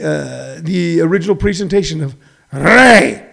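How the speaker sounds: ordinary talk, nothing marked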